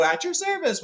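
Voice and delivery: high, bowing "at your service"; dwarves' greeting. Retold or imitated. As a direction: play a chorus of dwarves bowing. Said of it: "And they all go".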